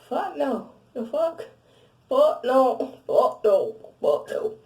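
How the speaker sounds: loud, building "fuck no"; emphatic refusal. Retold or imitated. Telling her ex she doesn't miss him, loud. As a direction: slam the refusal harder each time.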